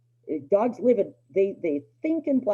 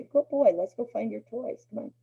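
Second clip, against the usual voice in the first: cheerful praise; 6 semitones higher than usual. Shown as a demonstration. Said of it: "I'd say".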